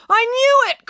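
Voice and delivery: high, loud burst of vindication; triumphant cry. A narrator's reading. April crying out that she was right. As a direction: shout it out, proven right. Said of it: "cried April".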